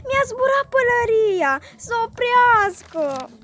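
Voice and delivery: slow, high, loud tearful wail; crying tantrum. A narrator's reading. Goe wails over his lost hat, crying tantrum. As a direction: stamp and sob like a spoiled child. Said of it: "bătând din picioare".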